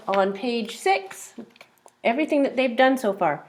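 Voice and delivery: ordinary talk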